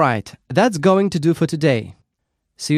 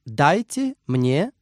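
slow model phrase; slower than usual. Shown as a demonstration. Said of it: "You can simply say".

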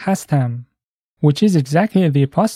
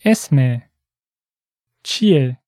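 slowly; 2 semitones lower than usual. Shown as a demonstration. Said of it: "One more time slowly".

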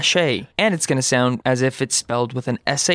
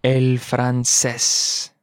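slow model; 3 semitones lower, much slower than usual. Shown as a demonstration. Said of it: "slow model for learners".

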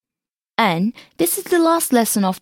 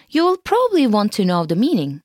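ordinary talk, nothing marked

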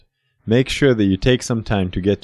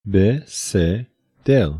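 slow; much slower than usual. Shown as a demonstration. Vocab repetition, slow.

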